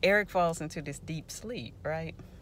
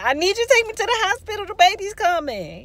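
very high, loud, rapid-fire insistence; exasperated retold yell; 8 dB louder, faster than usual. Woman in labor tells brother a third time, exasperated. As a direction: drive every word at him, frantic and loud.

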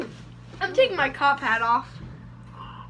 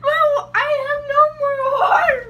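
laughing; 11 semitones higher, 6 dB louder than usual. Real, not acted.

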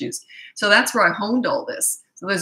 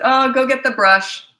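mock parent order; 7 semitones higher, 8 dB louder than usual. Parent telling kid to fetch brush.

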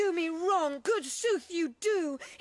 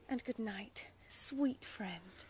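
whispered goodnight; 8 semitones lower, 9 dB quieter than usual. Acted. Hermia bids Lysander good night.

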